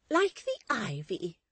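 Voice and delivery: high, loud, slow matron voice; emphatic assent. Acted. Mrs Chick accepts Miss Tox's simile, emphatic assent. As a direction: seize on the word, pitched right up. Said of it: "Missus Chick assented".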